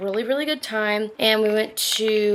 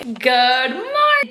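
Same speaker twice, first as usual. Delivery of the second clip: bright greeting; 3 semitones higher, 7 dB louder, much slower than usual. Real, not acted. Cheerful greeting to the camera.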